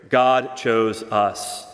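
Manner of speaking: "God chose us" spaced out very slowly, each word weighted; slow emphasis. Acted. Preacher driving home doctrine.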